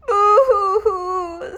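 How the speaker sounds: high, slow hammed-up boo-hoo; mock sobbing. Dogs sobbing at the monster, hammed-up sob. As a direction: bawl like a scared pup. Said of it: "sobbed the dogs".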